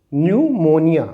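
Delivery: न्यूमोनिया said as numonia, no p; silent p demo. Shown as a demonstration. Teacher showing students the silent p in न्यूमोनिया. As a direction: start न्यूमोनिया on n. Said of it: "तो इसे हम पढ़ेंगे".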